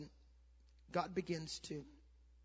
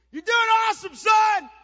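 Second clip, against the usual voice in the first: high, full-volume cheer; screamed encouragement; 20 dB louder than usual. Retold or imitated. Dad cheers on his benched son, screaming. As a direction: bellow praise across the field to your son. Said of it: "I'd scream out".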